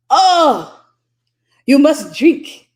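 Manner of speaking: put-on loud, high, slow German host accent. German host urging a guest to drink, German accent. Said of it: "I'm trying to remember the German accent".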